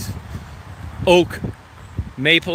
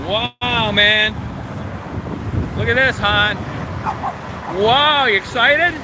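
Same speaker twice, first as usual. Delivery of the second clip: high, drawn-out cooing at the dog; 8 semitones higher, much slower than usual. Real, not acted. Owner cooing at excited Daisy at the lookout in high baby talk.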